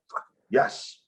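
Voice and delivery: a whispered, private fist-pump; whispered yes. Retold or imitated. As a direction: hiss a tiny victory to yourself. Quiet triumph after being allowed.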